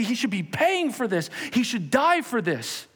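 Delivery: high, loud yelled demand; yelling accusers. Acted. Sanhedrin yelling for the sinner's death, high and loud. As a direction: yell for the death sentence. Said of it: "And they're yelling".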